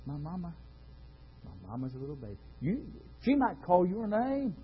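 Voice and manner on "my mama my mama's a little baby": put-on very soft, low child murmur; little girl playing. Little girl with her doll baby: hushed playing murmur. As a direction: croon it quietly to a doll. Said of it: "a little girl sitting there".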